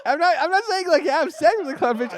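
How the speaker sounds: high, laughing through the words; giggling while explaining. Real, not acted.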